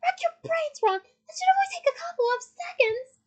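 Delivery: high, loud, scathing jibe; mock his slowness at full volume; yelled sarcasm. A narrator's reading. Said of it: "yelled Hermione with awful sarcasm".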